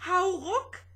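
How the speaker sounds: high, loud heave-ho; desperate call. A narrator's reading. Two spaceships straining against the storm, desperate call. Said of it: "riefen beide verzweifelt".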